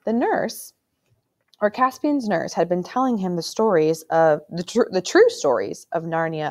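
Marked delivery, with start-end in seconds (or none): none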